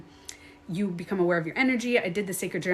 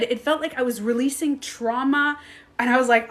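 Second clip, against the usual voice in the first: ordinary delivery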